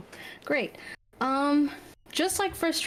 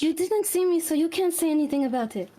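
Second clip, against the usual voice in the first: talking back; 6 semitones higher than usual. Acted. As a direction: snap back at your mother. Daughter talking back to mother.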